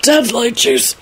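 high, slow choked voice; gasped, broken delivery; gasping. A narrator's reading. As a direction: force words out after choking. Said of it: "Neville gasped out".

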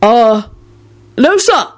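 high, loud, panicked stammer; desperate blurt. A narrator's reading. Ron denying it to Kingsley, desperate. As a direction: blurt it out to appease him. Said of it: "Ron blurted out desperately".

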